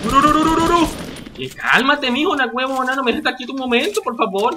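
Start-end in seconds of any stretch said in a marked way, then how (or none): 0.0-0.9 loud high nonsense gabble; panicked babble. Real, not acted. Streamer gabbles frantically under enemy fire.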